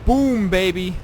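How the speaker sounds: loud gloating cheer; triumphant whoop. Real, not acted. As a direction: shout it in triumph. Gloating over explosion.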